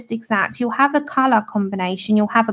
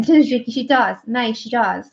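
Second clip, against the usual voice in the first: usual speaking voice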